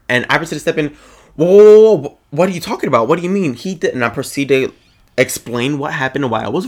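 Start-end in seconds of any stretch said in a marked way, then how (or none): 1.4-3.5 loud, high "whoa" easing into quick questions; retold raised-voice protest, starting big; alarmed objection. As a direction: act out jumping in to stop someone. Retells stepping in to object.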